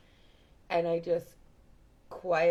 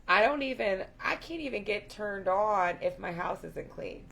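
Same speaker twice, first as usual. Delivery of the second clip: ordinary talk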